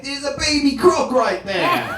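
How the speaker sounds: high, slow, laughing hint of an Australian accent; lightly Australian lifeguard. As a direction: tell him about the baby croc. Lifeguard points out baby croc.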